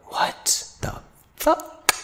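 breathless high whisper of disbelief; stunned whisper. Retold or imitated. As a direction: gasp it out in shock. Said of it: "I turned around, I was like".

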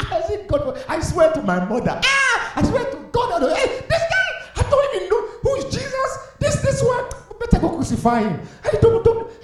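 hammed-up high squealing protest; swearing frantic denial. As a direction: deny it wildly, voice leaping very high. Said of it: "He cursed. He said".

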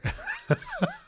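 a squealing laugh of disbelief; high shrieking laugh. Real, not acted. Laughing at the son's confession, a high shrieking laugh.

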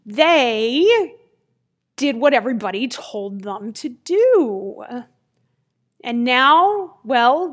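ordinary delivery, nothing marked

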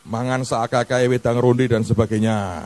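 ordinary talk, nothing marked

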